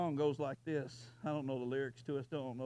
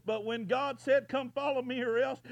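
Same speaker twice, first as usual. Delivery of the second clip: preaching cry; 9 semitones higher, 8 dB louder than usual. Retold or imitated.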